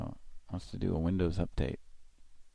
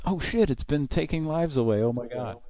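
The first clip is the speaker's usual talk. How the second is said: rising alarmed cries getting higher; freaking out, louder with each; panicked exclamation; 11 semitones higher, 5 dB louder than usual. Retold or imitated. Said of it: "and I realized".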